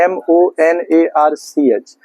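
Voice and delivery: slow, letter by letter; spelling out. Shown as a demonstration. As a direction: spell it out steadily for the class. Spelling the word out for students.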